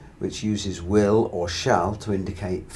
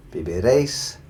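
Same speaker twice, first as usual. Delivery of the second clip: viviréis with emphasis on réis; viviréis, rising onto a strong réis; final-syllable stress. Shown as a demonstration. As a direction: punch the last syllable of viviréis. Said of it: "stressing that very last syllable".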